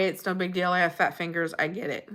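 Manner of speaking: ordinary talk, nothing marked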